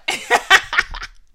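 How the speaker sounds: airy high giggle breaking out; helpless high laughter; burst of breathy laughter. Real, not acted. Cracks up at own joke.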